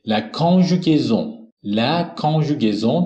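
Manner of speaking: La conjugaison drawn out slowly, every syllable clear; slow model pronunciation. Shown as a demonstration. Teacher introducing a new word, slow French model. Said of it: "نحوهٔ صرف فعل اتر".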